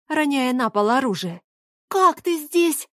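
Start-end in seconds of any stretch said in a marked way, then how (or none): 1.9-2.9 high, slow astonished question; amazed. Acted. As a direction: ask in high-pitched disbelief. Girl amazed at sudden visitor. (изумлённо, высоким голосом)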